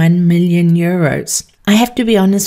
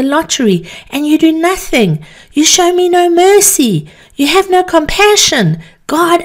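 anguished tirade; 7 semitones higher than usual. Acted. Ruined man raging at God, furious tirade. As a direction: rage at the silent heavens. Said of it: "he screamed to the heavens".

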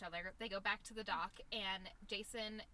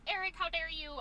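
mock outrage; 6 semitones higher, 6 dB louder than usual. Pam confronting Eric.

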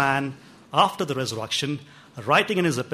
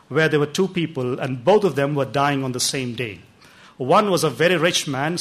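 ordinary delivery

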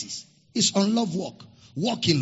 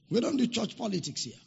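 normal talking voice

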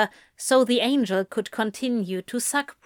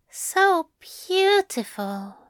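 high, slow tender coo; 9 semitones higher, slower than usual. A narrator's reading. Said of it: "Aziraphale cooed".

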